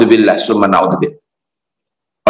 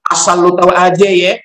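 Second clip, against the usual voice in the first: scolding Jibril. Acted. Angel Jibril rebukes Musa for sending away the sinner, much higher scolding voice. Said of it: "Malaikat Jibril menegur Nabi Musa".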